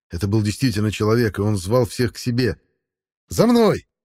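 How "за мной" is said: high loud rallying cry; shout. A narrator's reading. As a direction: call them all to follow. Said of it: "крикнул Денис".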